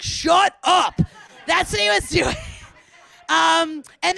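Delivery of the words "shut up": acted husband's emphatic shout; mock-angry, spaced-out yell; all-caps retort. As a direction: punch out each word like an all-caps text. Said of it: "in all caps, he'd be like".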